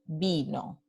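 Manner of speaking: "vino" low, with a long, clear "i"; highlighted I sound. Shown as a demonstration. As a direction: dwell on the "i" in "vino". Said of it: "Y también aquí". ("vino" alargando la "i")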